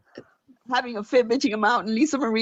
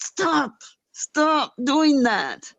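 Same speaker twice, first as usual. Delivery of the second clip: firm stop; 4 semitones higher, 3 dB louder, much slower than usual. Retold or imitated. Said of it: "And I'm like".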